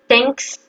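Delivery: THANKS with a clearly heard closing S; sounded final S. Shown as a demonstration. Teacher asking learners to hear the ending, sounded final S. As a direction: let the S ring out on THANKS. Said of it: "on prononce bien le S".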